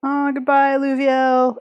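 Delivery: normal talking voice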